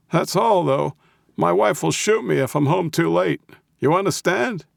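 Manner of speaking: put-on high-pitched cranky old voice; crabby old man. A narrator's reading. Old man setting a time limit for visitors, crabby. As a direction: insist on it like a cranky old codger. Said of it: "his best crabby old man voice".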